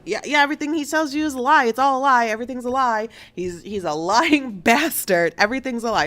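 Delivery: 3 rattle it off like an outraged ex-wife; 1 angry rant. Retold or imitated. 4 ex-wife on the phone to Risa, angry rant.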